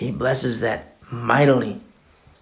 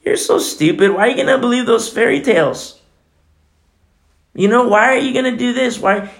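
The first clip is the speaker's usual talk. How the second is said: mocking scoffer; 10 semitones higher, 5 dB louder than usual. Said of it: "you'll have these dissenters".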